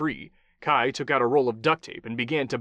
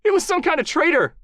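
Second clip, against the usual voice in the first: very high, loud frightened answer; high panicked voice; squealing terror; 7 dB louder than usual. Acted. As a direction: still squealing in fear. Beaten captive answering in terror.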